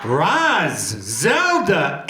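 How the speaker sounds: normal talking voice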